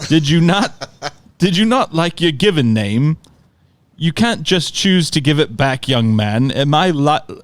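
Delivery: mock-formal London uncle; butchered London accent. Uncle writes back to Corey on Facebook, butchered London accent. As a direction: put on a lofty, bad London accent. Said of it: "I'm sure I will butcher his accent".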